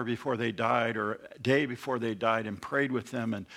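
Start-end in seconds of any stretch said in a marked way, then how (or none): none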